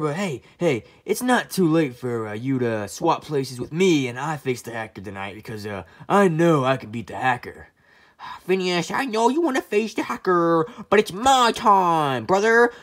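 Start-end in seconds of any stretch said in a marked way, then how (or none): 0.0-7.7 deep low pleading voice; low and coaxing; low character voice. Pretend. Ferb figure, low voice.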